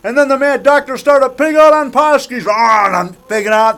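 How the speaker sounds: high-pitched, excited retelling; hammed-up retelling. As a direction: narrate the pig-out with mock frenzy. Acting out his movie scene.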